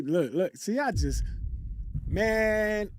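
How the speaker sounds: usual speaking voice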